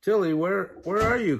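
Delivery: calling out for Tilly in the snow. Acted. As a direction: call for her through the storm. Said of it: "called Mister Melmo".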